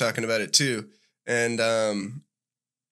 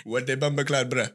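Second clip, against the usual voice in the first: put-on repeated Jamaican accent, softer; 3 semitones lower, 3 dB quieter than usual. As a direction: say the Jamaican line again, quieter. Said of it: "what'd you just say one more time".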